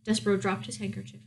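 usual speaking voice

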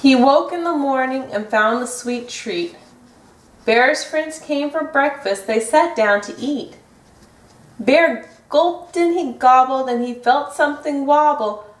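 plain reading voice

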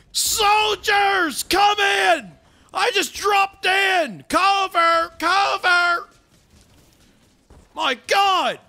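sergeant shouting into the radio, high and loud; drawn-out battlefield shout; Sarge. Acted. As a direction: yell orders like a sergeant under fire. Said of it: "can't do voices as I do Sarge".

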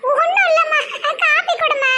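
put-on very high giggly girl voice; laughing. Daughter asking mother for coffee, laughing. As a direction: brush it off through giggles. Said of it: "சிரிச்ச மூஞ்சுடி வாரா".

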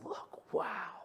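soft unvoiced awe; hushed wonder; whisper. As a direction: breathe the wow. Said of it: "takes the kids somewhere and goes".

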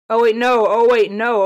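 loud, urgent repeated protest; alarmed. Real, not acted. Player sees own base taking damage, alarmed. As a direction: call out in sudden alarm.

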